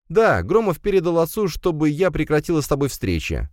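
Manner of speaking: high female character voice; girl friend, explanatory. A narrator's reading. Alisa answers Firs by phone, high girlish voice.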